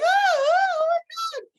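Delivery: high, loud imitation of a screaming hotel guest; comic scream of alarm; mock screaming. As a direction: shriek it as a hammed-up panic. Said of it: "starts screaming and is like".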